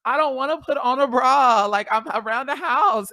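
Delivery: shrill whiny refusal; whiny loud protest. Retold or imitated. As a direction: protest loudly like a sulky kid. Arguing with friend about bras.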